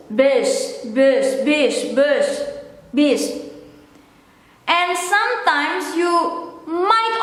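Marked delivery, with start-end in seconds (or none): 0.1-3.2 "bis" repeated with the vowel between "ee" and "oo"; lower, isolated repetitions of "bis". Shown as a demonstration. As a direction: repeat "bis", shading the vowel toward a rounded u. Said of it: "or in between".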